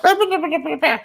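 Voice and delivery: very high mock chirping gibberish; chirping translator. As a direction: imitate rapid high-pitched chatter in gibberish. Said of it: "and she's chirping".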